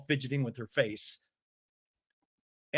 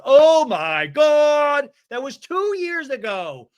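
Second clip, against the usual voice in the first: high, loud, drawn-out mock shout; exasperated outburst; 10 semitones higher, 9 dB louder, much slower than usual. Tamara defends herself in a retold quarrel, exasperated shout. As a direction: wail it out slowly in mock exasperation.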